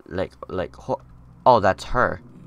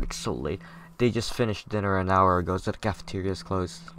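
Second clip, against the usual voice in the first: ordinary talk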